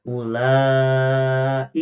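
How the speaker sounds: Ulaika chanted very slowly, wau short; slow Quranic chant; correct recitation. Shown as a demonstration. Teacher reciting the example verse, slow chant. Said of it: "tidak dipanjangkan".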